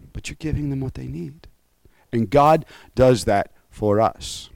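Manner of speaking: ordinary talk, nothing marked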